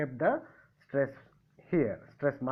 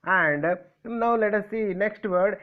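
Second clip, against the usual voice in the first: ordinary talk, nothing marked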